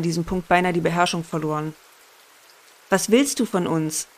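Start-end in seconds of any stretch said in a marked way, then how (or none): none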